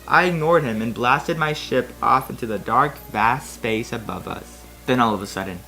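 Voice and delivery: ordinary talk, nothing marked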